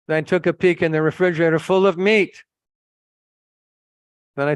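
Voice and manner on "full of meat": high, louder exclamation; indignant reveal. Retold or imitated. Visitor exposing what the refrigerator held, high and indignant. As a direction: hit the shocking find hard.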